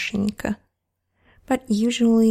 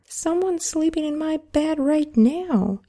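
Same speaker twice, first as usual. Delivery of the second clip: higher excited cub cry; exclaiming baby bear; 7 semitones higher than usual. Acted. Baby bear finds the girl in his bed, higher exclaiming. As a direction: blurt it out like a startled cub. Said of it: "exclaimed the baby bear".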